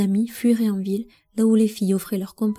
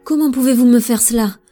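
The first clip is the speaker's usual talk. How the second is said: loud, furious, incredulous cry; furious disbelief; 3 semitones higher, 8 dB louder than usual. Acted. Prince raging at his queen mother, loud fury. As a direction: flare up in outrage. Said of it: "s'emporta-t-il, furieux et incrédule".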